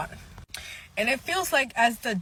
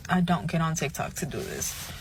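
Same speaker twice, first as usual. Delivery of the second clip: ordinary talk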